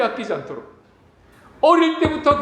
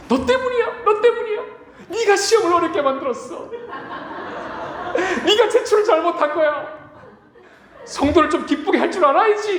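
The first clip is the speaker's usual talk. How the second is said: whiny blame; 8 semitones higher than usual. Retold or imitated. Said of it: "제가 지난주 엄청 힘들었거든".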